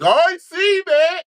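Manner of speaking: high, loud squealing fan voice; hyped-up fan. Acted. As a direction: shriek it like an over-excited fan. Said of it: "this fan base would be like".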